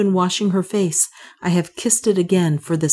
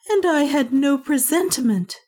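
much higher, an anguished cry; wife crying out in sudden alarm; cried out; 9 semitones higher than usual. A narrator's reading. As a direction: cry it out, shaken. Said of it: "she cried".

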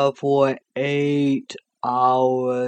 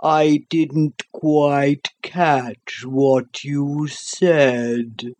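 sleepy creature voice; much slower than usual. Acted. The Sloth's voice.

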